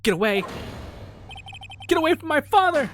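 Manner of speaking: high, loud desperate shout; desperate yell. Acted. Boy holds pistol and yells at the attacker, desperate shout. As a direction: scream at the attacker to back off.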